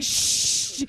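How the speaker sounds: loud whispered shush; long hissed shh; retold shushing. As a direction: hush someone urgently. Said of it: "He's like".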